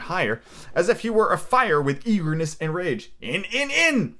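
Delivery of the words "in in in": high shout climbing on the last word; shouted command. A narrator's reading. Blind man urging his men inside, shouting high. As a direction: drive them in, pitch jumping up. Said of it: "he shouted".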